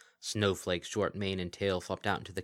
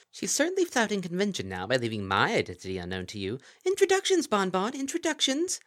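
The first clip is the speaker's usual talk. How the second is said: higher and louder, teasing and theatrical; playful Kelpie; 7 semitones higher, 5 dB louder than usual. Acted. The Kelpie to Lyra and Bon Bon, teasing grandly. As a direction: tease her with mock grandeur.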